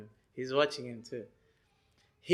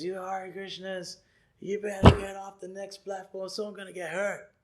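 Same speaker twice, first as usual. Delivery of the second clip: put-on swearing drunk guy; 4 semitones higher than usual. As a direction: imitate a drunk heckler, pitched up. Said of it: "He starts swearing".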